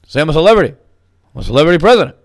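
loud mock Obama; Obama impression. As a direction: punch the word celebrity, mocking Obama. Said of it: "He goes".

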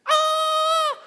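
very high, loud victory yell; joyful yell. Retold or imitated. Surfer celebrating his wave, joyful victory yell. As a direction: throw your arms up and yell.

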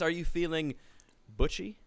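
usual speaking voice

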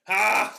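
one sharp loud high "Ha!"; imitating a friend's loud laugh; mock laugh bark. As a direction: blurt out a single burst of laughter. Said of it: "You went".

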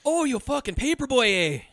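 high-pitched Canadian outrage; mock Canadian accent. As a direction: scold with a hammed-up Canadian lilt. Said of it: "We're so Canadian".

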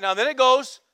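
ordinary talk, nothing marked

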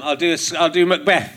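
ordinary talk, nothing marked

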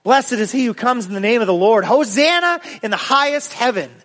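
building to a high ringing cry; crowd shouting. Acted. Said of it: "they shouted".

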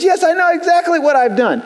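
high-pitched, matter-of-fact comeback; unapologetic retort. Acted. Acted friend replying in dispute.

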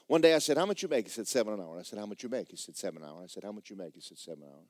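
worn-down back-and-forth, trailing off; fading repetition. Retold or imitated. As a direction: reel off a routine exchange, fading away. Retelling a tedious repeated exchange.

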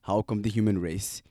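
ordinary talk, nothing marked

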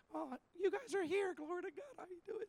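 tiny put-on greeting, fading away; subdued mock greeting. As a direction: mimic a hushed, small-voiced hello. Mimicking an underwhelmed greeting.

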